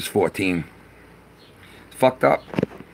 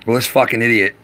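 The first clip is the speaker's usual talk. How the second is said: ordinary delivery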